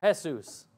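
"Jesus" said as "heh-SOOS"; Spanish pronunciation. Shown as a demonstration. Preacher giving another name, "Jesus" the Spanish way, heh-SOOS. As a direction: open "Jesus" on H, stress the last syllable. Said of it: "In Spanish".

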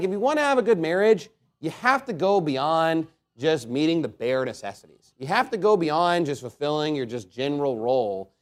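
ordinary talk, nothing marked

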